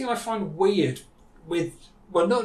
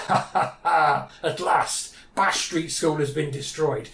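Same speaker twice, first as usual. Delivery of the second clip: slow mock villain laugh, then a triumphant announcement; gloating mayor; slower than usual.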